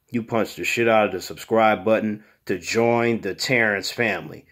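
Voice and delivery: ordinary talk, nothing marked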